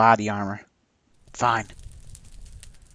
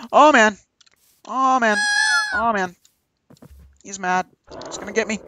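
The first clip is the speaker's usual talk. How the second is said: higher, louder panic; panicked; 8 semitones higher, 5 dB louder than usual. Real, not acted. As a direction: yelp it while running. Fleeing the angry dinosaur.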